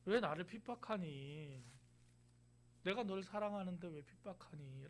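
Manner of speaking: tender question. Acted. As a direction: plead gently out of love. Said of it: "라고 말을 하시는 거예요".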